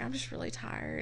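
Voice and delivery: whispered, barely audible; whispered excuse. Retold or imitated. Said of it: "I was like".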